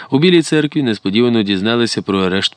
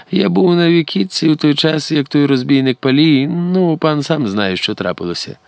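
higher-pitched fawning schemer, dropping at the end; ingratiating informer; 8 semitones higher than usual. A narrator's reading. Mnohohrishny hinting to noble lords, ingratiating. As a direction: voice a servile tattler, pitched up.

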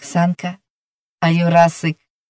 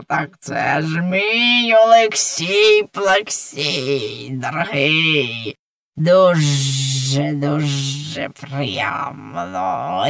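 a lightly voiced sly snake, lower and slow; 3 semitones lower, slower than usual. A narrator's reading. The serpent teasing the boy she missed, lightly voiced and sly.

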